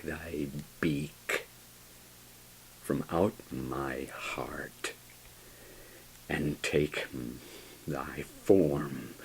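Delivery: slow, hushed breathy plea; slow whisper. Acted. Narrator begging the raven, whispered and slow. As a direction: whisper it slowly, almost gone.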